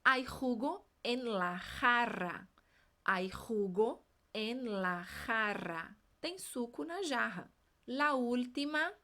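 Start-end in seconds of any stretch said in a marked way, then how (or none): 0.0-2.5 slow, careful sentence; throat J in "jugo" and "jarra"; slow drill. Shown as a demonstration. Teacher modeling slowly. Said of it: "Próxima frase". 3.0-6.0 slower and lower; throat J in "jugo", strong R in "jarra"; slow drill. Shown as a demonstration. Teacher repeating slower for the class. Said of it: "Próxima frase".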